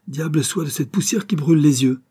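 high, irritated curse; exasperated outburst; angry exclamation. A narrator's reading. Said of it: "s'écria-t-il avec colère".

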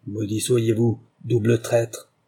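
plain reading voice

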